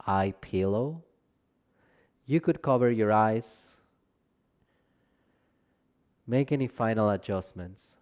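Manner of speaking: calm Yoga Nidra guiding voice with long pauses; guided meditation. Real, not acted. Teacher leading listeners into Yoga Nidra rest, calm guided meditation. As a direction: ease them into rest, gently, leaving space between phrases.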